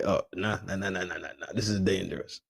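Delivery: soft run of nahs, imitated; mock wary retreat. Voicing the man backing out from danger, mock wary. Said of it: "He backed out of there like".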